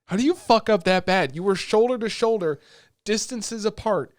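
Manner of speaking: loud, high disbelieving rant; exasperated rant. Retold or imitated. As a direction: yell at the screen in disbelief. Ranting at anime characters.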